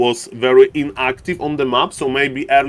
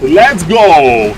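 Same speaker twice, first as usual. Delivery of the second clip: hype shout; 7 dB louder than usual. Real, not acted. Fight starts, caster hypes.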